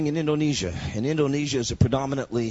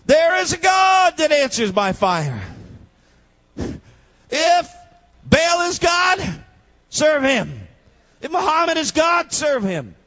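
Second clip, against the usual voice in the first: much higher, louder, slow proclaiming; full-force preaching; 12 semitones higher, 6 dB louder, slower than usual. Acted. Preacher challenging the congregation with Elijah's words, full-force shout. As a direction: thunder the challenge out.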